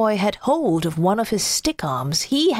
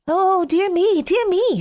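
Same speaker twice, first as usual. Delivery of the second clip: put-on high, worried child voice; little boy voice; 8 semitones higher than usual. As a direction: fret like a small boy. Boy dismayed in story.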